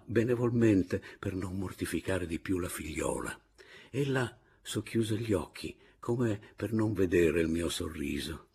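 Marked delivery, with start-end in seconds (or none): none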